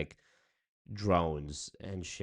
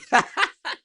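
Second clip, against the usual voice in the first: high burst of laughter; 11 dB louder than usual. Real, not acted. Laughs at own bit.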